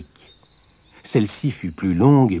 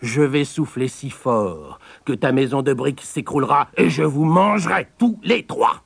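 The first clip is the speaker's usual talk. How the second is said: higher wolf voice, swelling to a climax; threatening wolf; 5 semitones higher than usual. Acted. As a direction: menace climbing high at the end. The wolf threatens all three.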